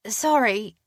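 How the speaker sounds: put-on timid young assistant; cringing. A narrator's reading. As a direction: apologize with a cringe. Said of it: "she cringed".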